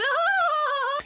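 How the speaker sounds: high burst of laughter; real laugh. Real, not acted. Laughing out of the accent bit.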